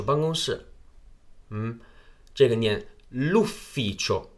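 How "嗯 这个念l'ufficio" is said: normal talking voice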